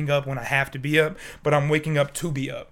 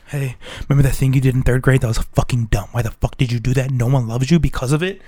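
lower, louder taunting inner voice; mock your own mind picking at you; nagging inner voice; 3 semitones lower, 5 dB louder than usual. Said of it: "I'll just lay in bed and be like".

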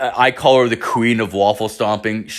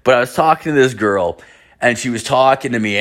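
ordinary talk

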